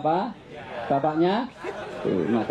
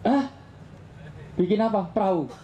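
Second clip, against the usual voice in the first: incredulous; 6 semitones higher than usual. A narrator's reading. Noah reacts to the order.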